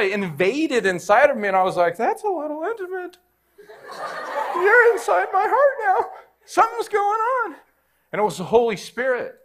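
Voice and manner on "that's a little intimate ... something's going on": much higher, half laughing, caught off guard; high flustered surprise. Retold or imitated. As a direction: squeak it out like someone startled by closeness. Holy Spirit felt inside him.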